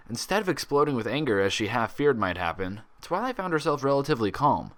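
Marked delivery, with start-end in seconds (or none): none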